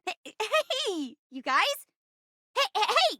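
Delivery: ordinary talk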